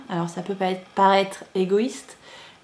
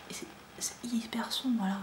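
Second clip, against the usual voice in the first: a soft whisper, much softer; whispered aside; 12 dB quieter than usual. Real, not acted. Vlogger fixing the lighting, murmured in a whisper. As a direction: mutter it to yourself while adjusting the camera.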